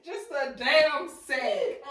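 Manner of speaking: laughing high through the words; laughing hard. Real, not acted. Cracking up at her co-host's joke, laughing. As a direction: squeal the words out between laughs.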